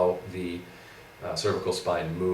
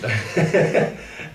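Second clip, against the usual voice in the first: high, loud laugh; 10 semitones higher, 6 dB louder than usual. Real, not acted. Co-host's sore-throat apology ends in banter, laughing. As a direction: burst out laughing.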